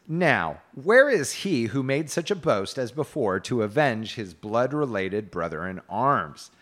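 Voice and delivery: loud, formal challenge read aloud; declaimed knight's speech. A narrator's reading. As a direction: declaim it like a storyteller quoting a knight. Reads Sir Kay's speech.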